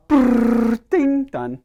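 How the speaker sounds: high buzzing whirr, then a bright ding; imitated machine whirr. Dad to workshop crowd, mimicking the computer's whirr and ding. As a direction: mimic a machine printing out and chiming done. Computer instantly spits out paper.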